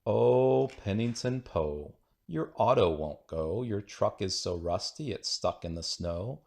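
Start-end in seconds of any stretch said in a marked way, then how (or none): none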